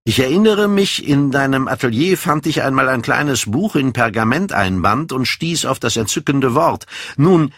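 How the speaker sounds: put-on animated young man, pitch often leaping up; lightly voiced, ardent youth holding forth; self-possessed young man.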